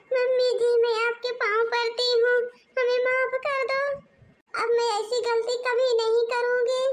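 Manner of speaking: high, loud desperate begging; tearful pleading. Acted. Younger daughter-in-law begs mother-in-law for forgiveness, tearful. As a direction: plead not to be thrown out of the house.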